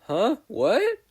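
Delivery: high startled huh, what; confused waking. A narrator's reading. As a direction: jolt awake, lost. Just woken, confused.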